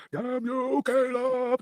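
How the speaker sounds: a mild mock yell from a drunk old man; hammed-up drunk cursing; imitating a yell. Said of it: "He's like".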